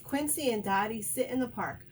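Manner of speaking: ordinary delivery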